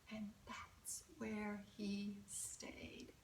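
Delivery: hushed, slow whisper. A narrator's reading. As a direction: breathe it out like a secret. Scared frog hiding under bed.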